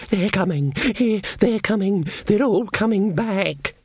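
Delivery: high, slow, drawn-out cry of alarm; panicked little creature. Acted. Small hunted god fleeing through the woods, panicked.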